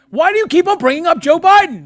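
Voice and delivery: very high, loud mock shout; exasperated yell. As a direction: yell it in hammed-up disbelief. Acted outburst peaks in a yell.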